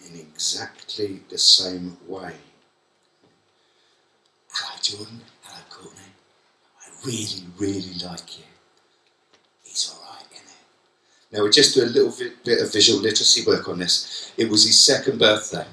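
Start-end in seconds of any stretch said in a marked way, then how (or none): 4.5-8.4 tender soft greeting. Acted. Greeting students tenderly, very softly. 9.6-11.4 whispered aside. Real, not acted. Whispered aside about his son.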